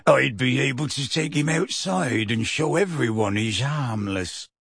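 plain reading voice

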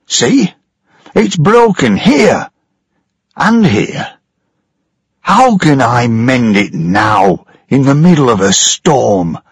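an anxious ship's engineer; frightened engineer. Acted. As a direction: engineer pointing out damage over the noise. Engineer shows broken parts.

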